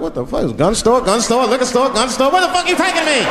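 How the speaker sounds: from hushed to high alarmed; nervous passenger seeing gun stores; growing panic. Retold or imitated.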